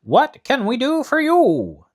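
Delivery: high, loud, slow monkey king; high, slow Monkey King asking. Acted. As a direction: ask in a changed, lofty voice. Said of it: "the King of the Monkeys asked".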